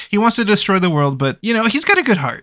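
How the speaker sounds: tongue-in-cheek apologist; mock defence. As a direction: sell the excuse, voice lifting. Mocking defence of the villain.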